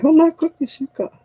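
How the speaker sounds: high astonished exclaim; lightly voiced amazed nurse. Nurses see her not flinch, high amazed exclaim. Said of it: "they'll say".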